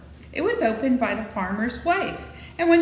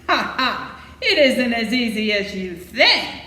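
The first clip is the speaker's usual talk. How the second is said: a hammerhead's mocking laugh, louder; harsh laugh; 3 dB louder than usual. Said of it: "the man laughed harshly as he said".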